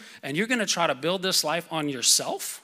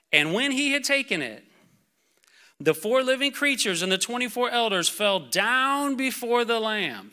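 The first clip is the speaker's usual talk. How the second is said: raised, emphatic preaching voice; impassioned scripture reading; 4 semitones higher, 4 dB louder than usual. Real, not acted. Preacher reads the scroll passage to the church, proclaiming.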